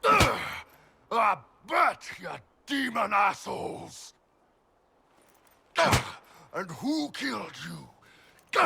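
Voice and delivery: loud, high jeering shout at the demons; a gleeful battle yell; shouting taunt. Acted. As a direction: yell it in triumph. Taunting slain demons in practice.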